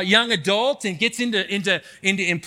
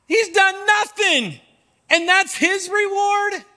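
complaining to God; 6 semitones higher than usual. Acted. Believer complaining to God about his reward, frustrated.

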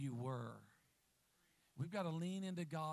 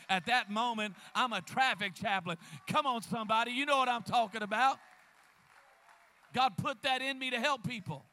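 full-force preaching; 3 semitones higher, 10 dB louder than usual. Acted. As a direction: preach it hard at the crowd. Preacher shouting the punchline.

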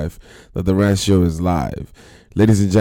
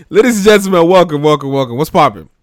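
loud host intro; 6 semitones higher, 8 dB louder than usual. Acted.

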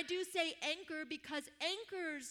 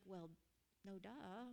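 much softer, under-the-breath no duh; mock the obvious quietly to yourself; inner sarcasm; 6 semitones lower, 12 dB quieter than usual. Girl's silent thought about the lady's advice, soft and sarcastic. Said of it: "in my head, just was like".

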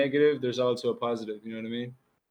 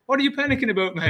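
much higher mock call of confusion; retold puzzled call; 11 semitones higher, 4 dB louder, faster than usual. Retelling his question to friend.

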